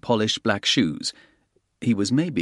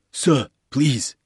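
porter pleading; 4 semitones higher than usual. A narrator's reading. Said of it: "the porter said".

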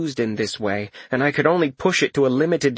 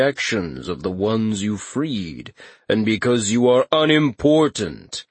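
booming Katashi voice; 2 semitones lower than usual. Acted. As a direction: speak down to a mortal, slowly. Katashi rebukes Corin.

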